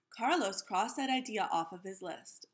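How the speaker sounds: usual speaking voice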